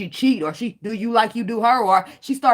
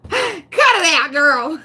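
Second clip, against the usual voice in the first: laughing tease; 7 semitones higher, 7 dB louder, much slower than usual. Real, not acted.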